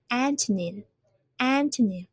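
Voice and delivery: AN-to-nym twice, first syllable strong; stress on the first syllable. Shown as a demonstration. Teacher drilling antonym for the class, stressing AN. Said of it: "put the stress on the first one".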